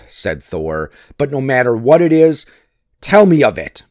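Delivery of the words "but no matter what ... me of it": louder, higher insistence; urgent demand. A narrator's reading. Thor insisting Loki tell him, urgent demand. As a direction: press forcefully for the answer.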